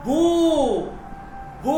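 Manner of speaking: long, stretched "বু"; "বু" drawn out as booo; long drawn-out vowel. Shown as a demonstration. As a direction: hold the oo in "বু". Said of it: "এই ধরনের লম্বা একটা টান দিবেন".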